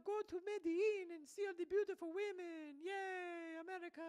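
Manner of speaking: put-on high, slow fawning Latin American accent, cheering at the end; fawning Latin American host. A Colombian host welcoming Americans, high gushing Latin American accent. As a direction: gush over the American guest in a Latin American accent. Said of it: "But it should be like".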